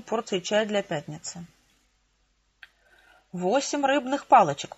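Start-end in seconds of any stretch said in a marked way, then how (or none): none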